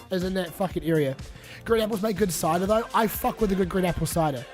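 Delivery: ordinary talk, nothing marked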